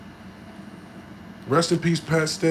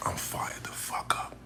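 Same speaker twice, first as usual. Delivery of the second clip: whisper; 9 dB quieter, slower than usual. Real, not acted. Sudden hush after loud hype.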